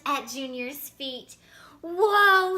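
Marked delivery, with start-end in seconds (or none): 1.8-2.6 loud, laughing whoa. A narrator's reading. A boy dodging chickens, laughing. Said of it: "he laughed".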